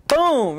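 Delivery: loud, high impact noise; imitated slap. Storyteller mimics mom's slap, explosive imitation. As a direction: punch out the blow as one sound.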